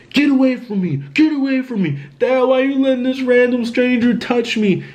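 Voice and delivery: high-pitched plea to be left alone; high, insistent retold complaint; imitated distressed brother. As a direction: act out the brother's upset protest, voice pitched far up. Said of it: "went back to my brother's voice".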